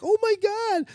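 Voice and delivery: very high astonished exclaim; mock high shriek of amazement; mom's shocked oh my god. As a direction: gasp like the stunned mom. Mom's shocked gasp retold.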